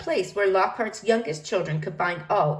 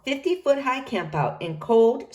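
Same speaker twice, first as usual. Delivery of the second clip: ordinary delivery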